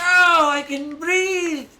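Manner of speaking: loud, high gasp of relief; freed toy figure. Pretend. Toy figure just let out of the box, loud relieved cry. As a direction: stretch it out loudly, high, like air at last.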